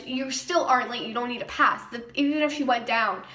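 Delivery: ordinary talk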